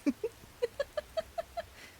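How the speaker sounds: high breathy squeal of a laugh; squeaky delighted giggle. Real, not acted. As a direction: let out a tiny squeaky giggle. Delighted at koi option.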